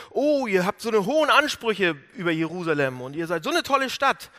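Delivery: lauter, höher, spöttisch aufgedreht; höhnisches Veralbern. Retold or imitated. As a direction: zieh sie laut und höhnisch auf. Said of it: "Die haben die veralbert". (Eroberer verhöhnen die Gefangenen, laut spottend)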